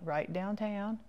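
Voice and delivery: ordinary talk, nothing marked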